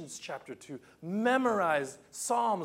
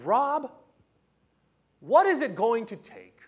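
frustrated plea; 6 semitones higher, 9 dB louder than usual. Retold or imitated. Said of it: "I said".

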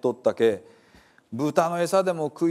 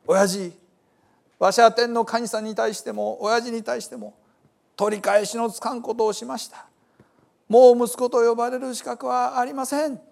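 high, raised-voice confession to a father; repentant son pleading; 8 semitones higher, 4 dB louder than usual. A narrator's reading. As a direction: cry out the confession, pitched well up.

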